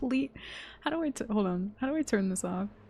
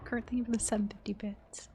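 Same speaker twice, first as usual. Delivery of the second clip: ordinary delivery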